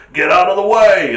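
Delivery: loud, commanding car horn with attitude; big assertive hooter. Retold or imitated. Driver honking to clear the road, loud and commanding. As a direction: boom it out like a horn that owns the road. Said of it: "it's got that attitude".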